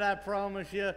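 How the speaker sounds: loud and upbeat. Real, not acted. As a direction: promise it loudly to the crowd. Loud promise to the crowd.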